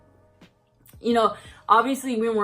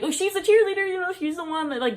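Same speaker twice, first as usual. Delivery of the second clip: panicked high voice; 9 semitones higher than usual. Retold or imitated. Panicked deflecting.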